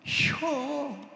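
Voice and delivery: high, drawn-out one-syllable Chungcheong answer; Chungcheong dialect. Retold or imitated. Preacher imitates Chungcheong folk answering, in high drawn-out dialect. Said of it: "충청도 사람들은요".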